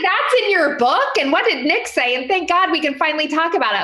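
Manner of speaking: a hint of a laughing, relieved brother, quick at the end; imitating her brother. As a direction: mimic a brother giddy it's finally out. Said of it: "He's like".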